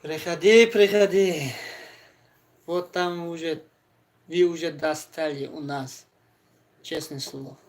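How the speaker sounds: ordinary talk, nothing marked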